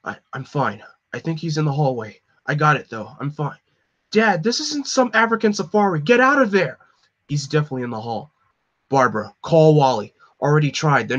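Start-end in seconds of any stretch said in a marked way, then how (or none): 4.1-6.8 frantic yell. Acted. Son yelling at his dad in danger, frantic shout. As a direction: yell at him to get out now.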